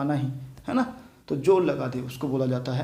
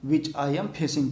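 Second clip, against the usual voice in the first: very slow, word by word; slow example sentence; 3 semitones higher, much slower than usual. Shown as a demonstration. Teacher reading the example slowly to students. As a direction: read it out slowly for the class. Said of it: "क्या बोल दे सकते हैं".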